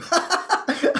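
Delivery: high burst of laughter; amused laugh. Real, not acted. Gardener laughing at her own cluelessness, a high giggle.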